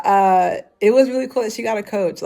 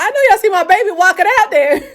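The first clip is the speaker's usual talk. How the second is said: higher, louder scolding Southern granny voice with a laugh; Southern grandmother; 14 semitones higher, 6 dB louder, faster than usual. Retold or imitated. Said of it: "turned into a Southern grandmother".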